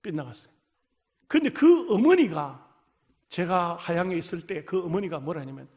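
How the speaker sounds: ordinary talk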